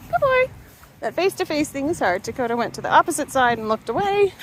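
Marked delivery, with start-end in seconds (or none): 0.1-0.5 high-pitched dog praise; squeaky praise for a pup; talking to a dog. Real, not acted.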